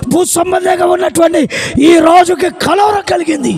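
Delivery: loud, high, slowed proclamation; full-force preaching. Acted. Preacher proclaims the newborn king to his church, thundering. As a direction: proclaim it to the rafters.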